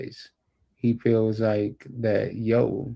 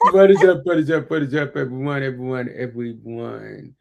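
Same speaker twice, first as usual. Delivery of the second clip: signature show greeting; 5 dB louder than usual. Shown as a demonstration. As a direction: belt out the catchphrase, slowing on the last word. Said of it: "now you get to do your".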